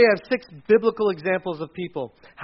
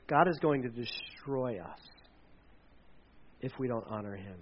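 fearful hush; 5 semitones lower, 11 dB quieter than usual. Acted. Frightened city people.

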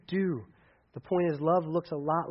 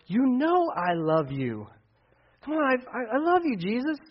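pleading devotion; 7 semitones higher than usual. Acted. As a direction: beg him to believe you. Peter pleading with Jesus.

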